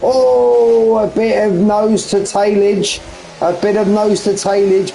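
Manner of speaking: high, drawn-out "oh" into the call; excited race commentary. Real, not acted. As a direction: react live to close racing. Live call of close racing.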